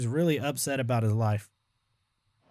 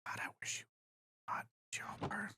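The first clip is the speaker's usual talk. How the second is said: whispered grumble; 17 dB quieter than usual. Real, not acted. Mutters at the game.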